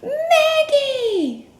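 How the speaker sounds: high, loud delighted cry with a laugh; excited cry. Acted. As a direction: cheer her arrival. Thrilled cry as Maggie arrives.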